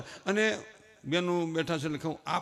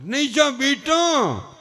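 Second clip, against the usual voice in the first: slow, loud, high coddling plea; drawn-out plea; 7 semitones higher, 8 dB louder, much slower than usual. Retold or imitated. Mother stopping son, slow drawn-out plea.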